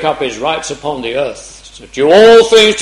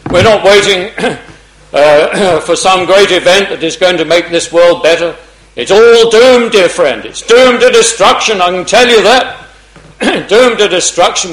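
higher, louder, driving preacher voice; impassioned preaching; 3 semitones higher, 7 dB louder than usual. Acted. Preacher urging the congregation, impassioned. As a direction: press each "safe" home.

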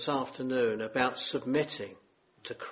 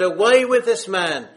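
loud, raised cry of rejection; leaders' loud cry; 6 semitones higher, 11 dB louder than usual. Retold or imitated. Religious leaders crying out against Jesus, loud mock shout. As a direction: shout it out like the angry crowd. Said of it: "They said".

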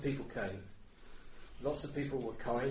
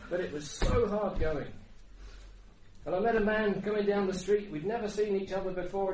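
higher, louder testimony from the pulpit; raised, impassioned preacher's voice; fervent preaching; 7 semitones higher, 6 dB louder than usual. Acted. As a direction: preach it out with rising energy.

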